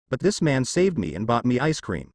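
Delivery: ordinary delivery, nothing marked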